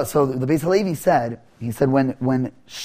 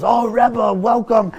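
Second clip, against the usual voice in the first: effusive welcome; 7 semitones higher, much slower than usual. Acted. Innkeeper fawns over honored guest.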